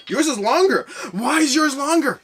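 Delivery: loud, high-pitched protest; playful indignation. Real, not acted. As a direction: protest loudly, stretching out the question. Mock outrage comparing sword lengths.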